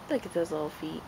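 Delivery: hushed, tender cooing; soft cooing. Real, not acted. Cooing over baby feet.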